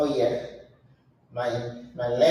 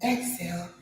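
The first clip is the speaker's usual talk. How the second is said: softer, calm breathing cues; guided breathing; 2 semitones higher, 7 dB quieter than usual. Acted. Leading her viewers through breathing, softer and calm.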